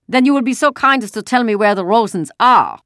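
put-on loud, high-pitched German-accented demand; overbearing officer, forcefully projected; loud, unlowered voice. A narrator's reading. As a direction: make no effort to keep it down. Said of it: "He made no effort to lower his voice".

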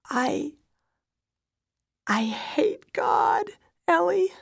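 high, loud, halting anguish; starting to wail. A narrator's reading. Widow to her small daughter, wailing. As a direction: break into a slow, anguished cry. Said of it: "I start to wail".

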